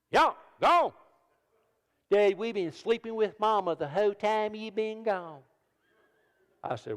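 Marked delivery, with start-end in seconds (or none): 0.1-0.9 high, loud mock shoo; shooing them off in a put-on voice; high-pitched comic shoo; shooing the boys. Father shooing boys from bed. 2.1-5.4 put-on higher whiny child voice; lightly voiced child protesting; kid's voice. Preacher voicing his young son.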